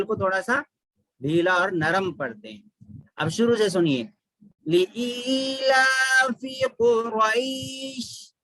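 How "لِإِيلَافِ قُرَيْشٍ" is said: slow drawn-out melodic chant; Quranic recitation. Shown as a demonstration. Teacher recites to students, slow tajweed chant. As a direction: recite it slowly with long held vowels.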